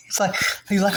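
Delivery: ordinary talk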